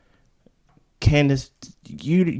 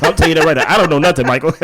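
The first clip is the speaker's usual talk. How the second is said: louder, higher, through laughter; laughing disclaimer; 3 semitones higher, 5 dB louder, much faster than usual. Real, not acted. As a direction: laugh while disowning all knowledge. Joking disclaimer while laughing about lawsuit.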